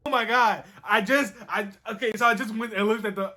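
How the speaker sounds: normal talking voice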